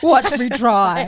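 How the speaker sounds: slow, high, loud grudging drawl; begrudging. Shown as a demonstration. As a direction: say it like you resent it.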